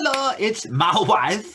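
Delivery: high, slow mock echo of her tearful line; mimicking her. Co-host teasingly imitating her, lightly high. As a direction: tease her by copying her wail.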